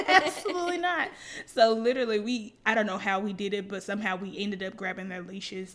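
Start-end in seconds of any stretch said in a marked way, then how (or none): 0.1-1.1 high-pitched protest breaking into laughter; laughing refusal. Real, not acted. Teller recalling dogs that cornered them, laughing refusal. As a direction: refuse loudly through real laughter. Laughing over retold dog scare.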